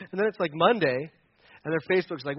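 ordinary delivery

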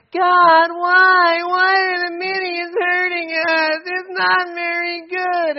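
put-on high, slow, whiny child voice; nasal-free whimper of a spoiled child; whining. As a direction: whine it like a kid who wants sympathy. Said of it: "Stop your whining".

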